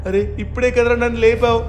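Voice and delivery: big brother in a panic calling for Shinchan; panicked. Acted. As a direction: search desperately for your little brother. Panic continues.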